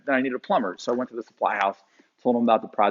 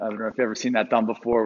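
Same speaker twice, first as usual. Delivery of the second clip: normal talking voice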